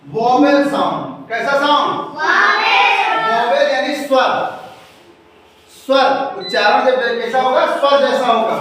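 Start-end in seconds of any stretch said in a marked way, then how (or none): 2.2-3.0 chorus answer. Acted.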